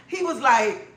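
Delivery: normal talking voice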